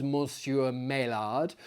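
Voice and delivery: ordinary delivery, nothing marked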